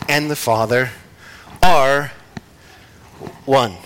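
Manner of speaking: "and the Father", "are", "one" split by long pauses; a long pause either side of a firm "are"; broken-down reading. Shown as a demonstration. Preacher breaking down the verse, "are" and "one" each standing alone. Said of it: "four different ways that it's broken down".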